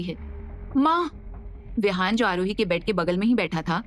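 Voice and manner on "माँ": sudden high cry out of a dream; startled cry. A narrator's reading. Aarohi waking from a nightmare, crying out for her mother. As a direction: jolt awake calling out high. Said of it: "झटके के साथ चिल्लाते हुए".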